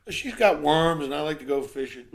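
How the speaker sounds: high, loud comeback; shouted punch line. Acted. Groom explaining his marriage to the concierge, loud defensive shout. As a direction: belt out the groom's answer.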